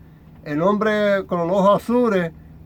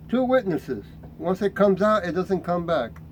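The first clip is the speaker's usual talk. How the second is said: ordinary talk, nothing marked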